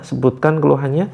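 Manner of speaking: usual speaking voice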